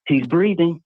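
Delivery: imitated exclamation. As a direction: call it out as the man on the video. Quoting man heard on boat video.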